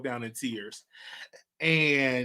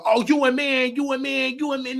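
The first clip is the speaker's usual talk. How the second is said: mocking taunt; 9 semitones higher, 5 dB louder than usual. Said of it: "going up to them and saying".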